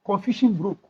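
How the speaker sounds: ordinary talk, nothing marked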